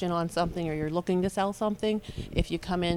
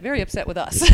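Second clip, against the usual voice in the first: usual speaking voice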